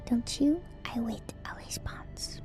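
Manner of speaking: usual speaking voice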